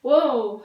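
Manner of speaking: "ओ" said long with lips rounded into a circle; rounded ओ demo. Shown as a demonstration. Teacher to pupils, demonstrating round-lipped "ओ". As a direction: show the round "ओ" slowly. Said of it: "pronounce कीजिए".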